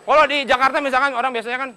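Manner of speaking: usual speaking voice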